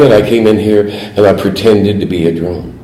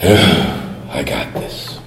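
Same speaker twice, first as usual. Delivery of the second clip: softly whispered to himself, very slow; 6 semitones lower, 9 dB quieter, much slower than usual. Pretend. Drinker assuring himself the voices calmed down, whispering. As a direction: breathe it out, soothed. Mind calming after a drink.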